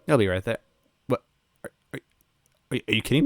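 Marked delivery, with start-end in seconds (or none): none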